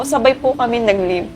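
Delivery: ordinary delivery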